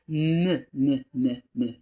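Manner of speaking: "Me" repeated loudly, M plus "euh"; isolated syllable me. Shown as a demonstration. Teacher isolates the syllable of melon for pupils, loudly sounded out. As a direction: drill "me" in loud, separate beats. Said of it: "La syllabe me".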